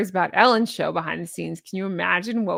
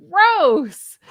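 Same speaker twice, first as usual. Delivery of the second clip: loud, high burst of disgust; 7 semitones higher, 8 dB louder than usual. Real, not acted. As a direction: blurt it out, grossed out. Reacting to quoted comment.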